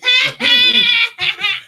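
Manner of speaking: sudden high whooping laugh; loud burst of laughter. Real, not acted. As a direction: let out a loud squealing laugh. Laughing at host spelling.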